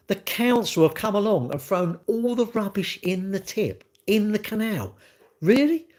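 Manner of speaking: high-pitched, disbelieving rhetorical questions; incredulous retort. Retold or imitated. Snapping back at people blaming the council, incredulous. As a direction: voice leaps up in exasperated disbelief.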